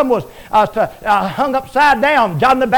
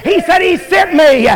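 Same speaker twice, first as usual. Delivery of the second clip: fiery preaching; 4 semitones higher than usual. Acted. Preacher shouting triumph to the congregation, full force. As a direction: preach it to the rafters.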